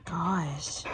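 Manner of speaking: usual speaking voice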